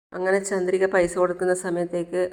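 usual speaking voice

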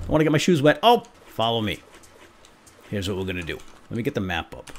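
normal talking voice

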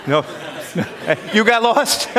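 high, loud amused disbelief; laughing at the student's surprising answer; laughing surprise. Real, not acted. Teacher reacts to a student who got lost, amused surprise.

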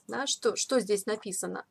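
ordinary talk, nothing marked

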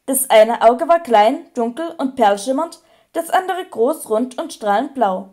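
plain reading voice

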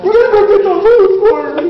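loud, high-pitched order; bossy shout. Acted. Boss sending henchmen for trees, high loud command. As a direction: order them off at full volume.

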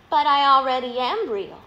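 plain reading voice

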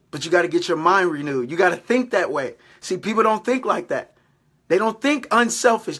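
usual speaking voice

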